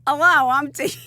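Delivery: high, loud mock introduction; lawyer's self-parody. Lawyer answering a tease about her accent, mock introduction. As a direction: send up your own accent, loudly.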